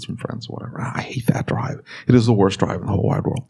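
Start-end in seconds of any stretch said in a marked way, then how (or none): 0.0-1.8 hushed whispered grumble. Real, not acted. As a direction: whisper it like a confession. Aside about hated drive.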